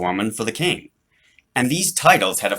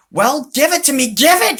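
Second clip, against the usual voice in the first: high, loud demanding yell; boy shouts at the robot butler to hand it over; impatient shout; 7 dB louder than usual. A narrator's reading. Charlie yelling at his robot butler for the delivery, loud and high. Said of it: "Charlie shouted".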